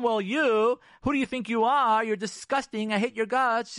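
loud, high-pitched indignant outburst; angry retort. Acted. Wife hitting back at her husband, angry and high. Acted spousal insults, forbidden retort.

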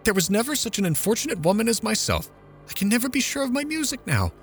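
mother lamenting to a friend, self-pitying; aggrieved lament. A narrator's reading. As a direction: complain as the unluckiest woman alive. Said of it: "she exclaimed".

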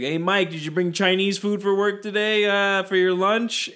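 a light New Jersey guy, drawn out at the end; Jersey accent. Retold or imitated. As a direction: ham up a New Jersey coworker asking about lunch. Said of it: "I'm gonna do a Jersey one".

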